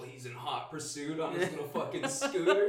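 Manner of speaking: ordinary talk, nothing marked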